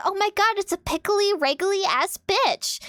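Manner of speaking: high, slowing mock-sassy kid voice on the insult; sassy little girl. A girl on Omegle sassing a stranger, in a high mock-sassy voice. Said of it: "And the girl's like".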